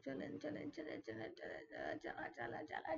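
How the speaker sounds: whispery, very soft repeated chant; hushed urging. Real, not acted. Gamer coaxing through a tricky climb, hushed repeated urging. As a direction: urge it along under the breath.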